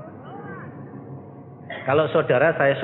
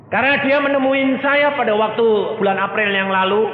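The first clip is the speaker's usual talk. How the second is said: fervent preaching; 9 semitones higher, 6 dB louder than usual. Acted. Preacher exhorting the congregation about God's set time, fervent. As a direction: drive the point home to the congregation.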